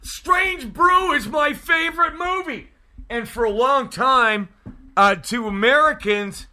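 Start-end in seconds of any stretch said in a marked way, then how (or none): 0.0-2.7 excited shout. Retold or imitated. Hair Boy meeting the Canadian at the lake party, excited shout. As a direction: belt it out like an overjoyed partier. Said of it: "he says".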